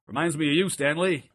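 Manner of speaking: a hint of a Fred Willard commentator; pompous commentator. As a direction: play it like a daft TV pundit. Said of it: "is affecting the kind of tone".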